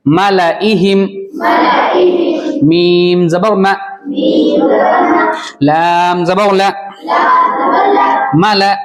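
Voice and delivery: slow chanted spelling of letters and vowels; Quran spelling drill. Shown as a demonstration. Teacher chanting the spelling for young pupils.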